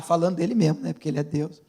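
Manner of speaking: usual speaking voice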